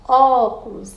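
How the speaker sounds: "Óculos" with a loud, lengthened Ó; "Óculos" leaning hard on the opening Ó; stressed first syllable. Shown as a demonstration. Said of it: "Então, a primeira".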